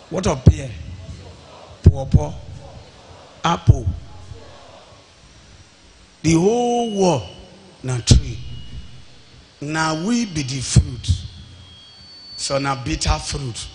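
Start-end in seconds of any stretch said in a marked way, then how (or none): none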